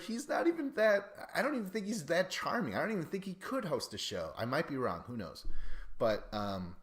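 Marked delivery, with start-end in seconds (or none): 0.0-4.3 high, louder mocking rant; scoffing disbelief. Retelling annoyance at the host getting a show, high scoffing rant. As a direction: scoff at the idea he could host. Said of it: "I was like".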